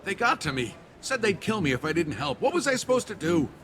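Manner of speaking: high, rising frantic excuse; panicked self-defence; desperate pleading. Acted. Cornered man justifies his betrayal.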